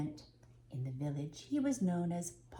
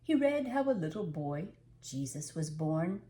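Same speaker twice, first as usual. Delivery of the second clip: usual speaking voice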